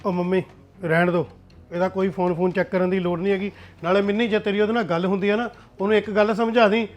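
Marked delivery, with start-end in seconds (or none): none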